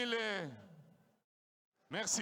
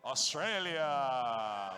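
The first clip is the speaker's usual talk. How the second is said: drawn-out announcer call; 8 semitones lower, much slower than usual. Acted. Ring announcer calling out country.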